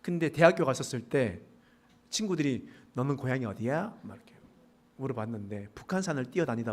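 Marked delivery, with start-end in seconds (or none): none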